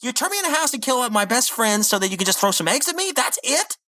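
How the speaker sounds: high, squeaky outrage; mock disbelief. Rigby pelted with eggs, squeaking in high outraged disbelief. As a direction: sputter in disbelief. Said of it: "Rigby's like".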